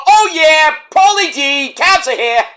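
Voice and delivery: high, hammed-up reality-show impression; Sammy Sweetheart. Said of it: "Sammy Sweetheart from the Jersey Shore".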